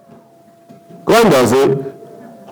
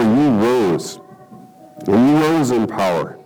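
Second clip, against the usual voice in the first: prayer; 4 semitones lower than usual. Acted. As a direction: pray it sincerely before the church. Pastor leads congregation in prayer.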